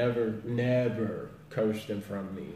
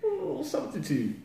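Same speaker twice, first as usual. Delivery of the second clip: high, sing-song nudge to swap the word; mock correction. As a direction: prompt them brightly to use another word. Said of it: "So I look at 'em, you know, like".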